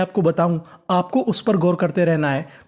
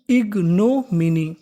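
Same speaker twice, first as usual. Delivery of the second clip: Ignominy slowly broken into ig, no, mini; syllable by syllable; much slower than usual. Shown as a demonstration. Teacher putting ignominy together for students, slowly and in pieces. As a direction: stretch ignominy out part by part. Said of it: "तो meaning बनी".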